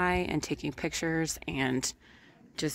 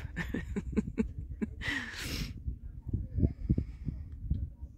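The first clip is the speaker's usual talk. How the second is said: breathy high nervous giggle; nervous laugh; 6 semitones higher, 5 dB quieter than usual. Real, not acted. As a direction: laugh off the nerves. Laughs after saying she can't leave.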